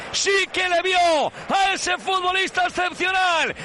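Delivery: goal commentary. Real, not acted.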